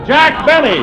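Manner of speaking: loud, high showman's call of the name; announcer's big introduction. Acted. Announcer calling out the star's name to the audience, loud and high. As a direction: boom it out to bring on the star.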